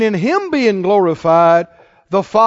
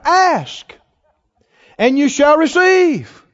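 higher, slow, ringing preaching shout; climactic preaching; 7 semitones higher, slower than usual. Acted. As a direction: ring it out to close the sermon. Sermon climax.

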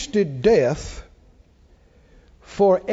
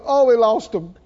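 mild mock lament amid laughter; mock moaning; 3 semitones higher, 3 dB louder than usual. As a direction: pretend to moan over a loss. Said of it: "you wouldn't moan and go".

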